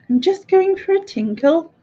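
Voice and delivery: high, affected sing-song voice; dainty put-on voice. Acts out someone using dainty phrase.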